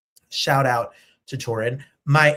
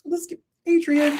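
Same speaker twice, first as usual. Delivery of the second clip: excited announce; 13 semitones higher, 3 dB louder than usual. Real, not acted. Calling the segment.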